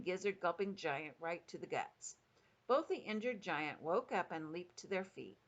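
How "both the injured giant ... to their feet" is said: ordinary delivery, nothing marked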